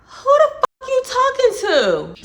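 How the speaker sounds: shrill-pitched yelling comeback; high shrieking retort. Retold or imitated. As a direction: screech it back at him. Her retort in the story.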